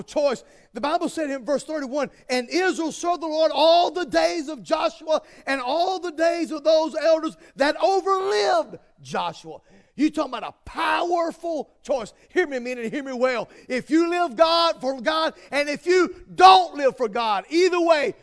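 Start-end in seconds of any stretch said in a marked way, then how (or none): 2.3-9.6 loud, high scripture read; preaching shout. Real, not acted. Preacher quoting Bible loudly. 13.7-18.1 loud slow preaching; preaching shout. Real, not acted. Preacher at full force.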